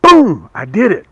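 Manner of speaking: loud, high burst of triumph; excited shout. Real, not acted. Maker shouting to viewers over finished lures, excited. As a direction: punch it out like you just won.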